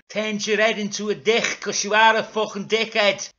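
loud, high character rant; hammed-up scornful shouting; angry taunt. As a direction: hammer the insult home. Zebedee angrily punishes Dougal.

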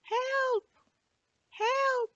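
tiny, very high cry for help from far off; a small voice calling weakly from outside; faint cry. Acted. Melting biscuit calling up from below the window in a tiny, very high voice. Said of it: "a faint voice came through".

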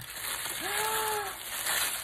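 long, high gasp of delight; drawn-out astonished gasp; shocked gasp. Real, not acted. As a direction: gasp in disbelief.

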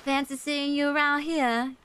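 drawn-out Southern US demo; Southern American accent. Shown as a demonstration. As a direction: put on a folksy Southern accent. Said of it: "that kind of accent?".

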